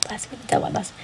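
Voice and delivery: fast, hushed repeating under the breath; hurried half-voiced chant; rapid muttered repeat. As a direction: rattle the words off quickly and softly. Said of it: "repeating it in a very fast".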